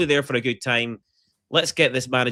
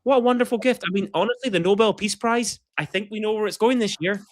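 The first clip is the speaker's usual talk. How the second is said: a lightly voiced, higher gushing American fan; 7 semitones higher than usual. Mocking grateful American fans for co-hosts, high gushing voice. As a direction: gush like a grateful fan, pitched up. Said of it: "everyone's like".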